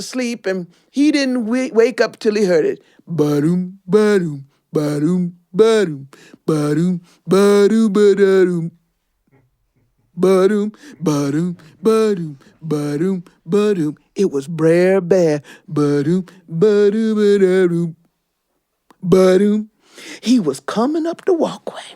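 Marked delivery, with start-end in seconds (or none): 3.1-19.7 rhythmic ba-doom footsteps; chanted bear footsteps. Acted. Brer Bear's steps approaching, rhythmic chant. 20.2-21.8 suspenseful whisper. A narrator's reading. Telling kids the bear is coming, whispering.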